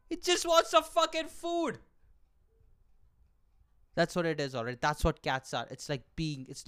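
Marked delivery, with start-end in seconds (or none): 0.1-1.8 high, slow comic shout; outraged punchline yell; exasperated outburst. Acted. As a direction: burst out with it, stretching each word. Outraged punchline after a quiet setup.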